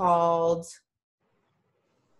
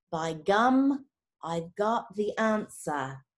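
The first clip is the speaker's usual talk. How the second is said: normal talking voice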